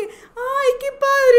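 high, loud excited cry of joy; excited quote. Retold or imitated. Quoting Carlos celebrating the repost, excited cry. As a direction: voice his thrill high and loud. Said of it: "Carlos de que".

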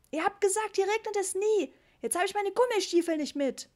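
put-on high, loud cross little boy; a small kid bawling out his parents; child yelling. A narrator's reading. Ritchie yells at his parents about the rain, high and loud. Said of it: "brüllte Ritchie wieder".

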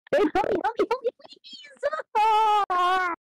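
high, drawn-out wailing cry for help; desperate high whine of help; panicked plea. Real, not acted. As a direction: beg your partner to save you, stretching the words. Dying in game, begging partner.

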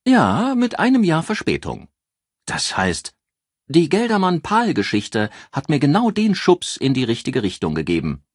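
high-pitched, bubbly and loud; a showy editor who loves her own story; lively newspaper editor. A narrator's reading. Joyce tells the detective about her promotion, bubbly and high.

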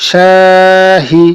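slow, level, sustained first vowel in "শাহি"; stretched reading. Shown as a demonstration. Teacher reading through for students, "শা" drawn out. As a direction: hold "শা" long in "শাহি", then short "হি". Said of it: "টানা পড়ে চলে যাবো".

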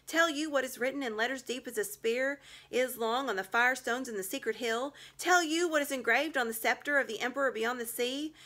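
high, loud triumphant witch tirade; rising shrill gloating. A narrator's reading. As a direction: witch claiming her right to the traitor. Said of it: "her voice growing suddenly shriller".

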